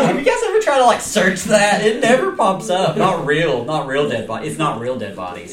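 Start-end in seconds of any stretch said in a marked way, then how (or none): 0.0-3.0 mock innocent. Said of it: "You're like".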